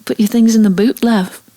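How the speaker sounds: lightly put-on Australian twang; Aussie accent. Host asks for an accent, a light Australian voice. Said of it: "could you do him in a Kiwi accent".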